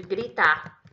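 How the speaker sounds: ordinary talk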